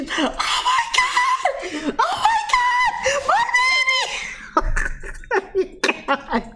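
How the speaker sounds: high-pitched, drawn-out panicked shriek through laughter; mock screaming mom. Mom finding her baby under the pillow, mock screaming. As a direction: imitate a horrified mother, stretching the cry out high. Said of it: "screaming at me".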